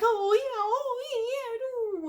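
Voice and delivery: high copied karate yelps; mock karate cries. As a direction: echo his silly karate noises. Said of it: "copies a little bit".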